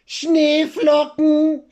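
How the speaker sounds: high, slow, drawn-out punchline; silly punchline. Acted. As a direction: stretch the answer out high and silly. Joke punchline.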